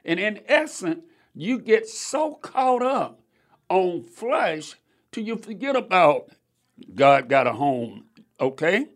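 normal talking voice

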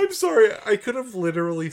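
high, loud startled "I'm sorry"; surprised outburst. Real, not acted. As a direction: blurt it out in surprise.

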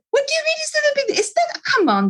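high, rushed mock protest; squeaky-high retold objection; indignant client. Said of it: "they look at me and say".

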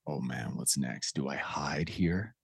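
low, slow, whispered fear; fearful whisper. Retold or imitated. Host voicing a scared boy seeing his father, whispering. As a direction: hush it like a scared kid hiding. Boy's fearful inner thoughts.

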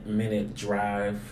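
normal talking voice

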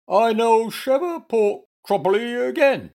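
high, loud, woeful bear voice; muddled bear in distress. A narrator's reading. Bear fears for his speech, high muddled wail.

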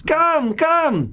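loud high festive barker call; booming sing song welcome. Acted. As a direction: boom it out like a carnival host. Barker keeps calling.

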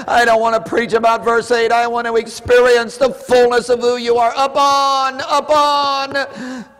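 high, loud fervent pleading; full-force preaching prayer. Real, not acted. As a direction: pour it all out to God at the top of your voice.

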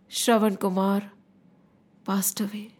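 low, softening death announcement; solemn, falling narration; hushed sorrow. A narrator's reading. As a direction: let it sink and fade into quiet. Narrator tells of boy's death.

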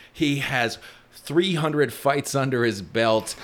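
usual speaking voice

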